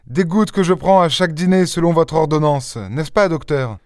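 put-on high young woman's voice, easing down at the end; Jeanne, young woman explaining. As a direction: explain it in a high voice, seeking confirmation. (jeune fille qui s'explique, voix aiguë)

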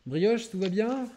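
high, slow, gentle pet voice; talking to a pet. Real, not acted. Talking to the dog.

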